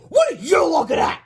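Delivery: high loud snarling shout; angry snarl. Acted. Principal snarling at the teacher. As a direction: snarl it at her.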